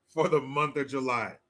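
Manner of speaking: ordinary talk, nothing marked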